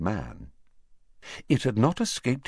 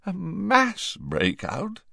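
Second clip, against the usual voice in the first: hoarse; 11 semitones higher, 4 dB quieter, slower than usual. A narrator's reading. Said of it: "repeated hoarsely".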